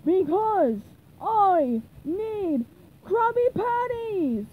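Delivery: high, loud, emphatic shout; desperate cartoon bellow; hungry yell. A narrator's reading. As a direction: shout it in drawn-out chunks. Patrick hungrily yells his reason.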